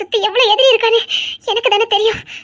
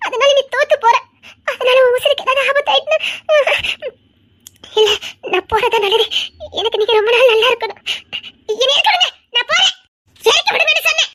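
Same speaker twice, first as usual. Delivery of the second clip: tearful pleading; 5 semitones higher than usual. Acted. Wife pleading, leaving husband.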